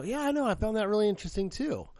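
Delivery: high, loud fake-sincere agreement; mock earnest agreement. Student trolling the teacher, a high mock-sincere agreement. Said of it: "And I go".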